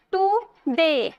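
Today very slow, tu... de; two drawn-out beats on today with a gap; split into syllables. Shown as a demonstration. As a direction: pull today apart to count syllables. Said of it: "दो syllable आया ना".

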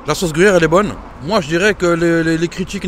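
chewing while talking; talking between bites of food; mouth full. Real, not acted. Seen 2: Man bit the tacos just before, holds it at his mouth while talking.

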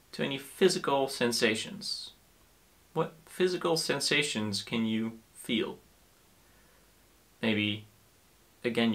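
soft, calm guiding voice with long pauses; guided meditation. Acted. Teacher inviting listeners to notice physical sensations, soft guided meditation. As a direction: gently invite attention, leaving space between phrases.

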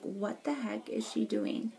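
hushed, slow mock of judging strangers; mocking onlookers. Imitating judgmental onlookers behind her window, snide and hushed. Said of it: "are always looking at me like".

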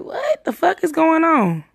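high, drawn-out retold disbelief; bewildered. Friend retelling her reaction to his claim, bewildered.

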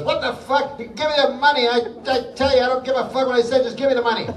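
hammed-up angry husband snapping at his wife; mock angry outburst. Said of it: "the guy blurts out to the woman".

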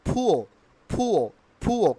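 "ফুয়ো" with puffed P, no R; correct pronunciation. Shown as a demonstration. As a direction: say "ফুয়ো" as "phu-o". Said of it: "শেষে আর থাকলে আর উচ্চারিত হয় না". ("ফুয়ো", শেষে র ছাড়া)

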